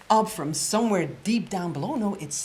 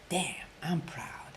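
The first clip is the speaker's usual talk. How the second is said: slow whispered satisfaction; quiet whispered pride; proud whisper; 7 dB quieter, slower than usual. Retold or imitated. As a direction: savour it under your breath. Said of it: "you get to say".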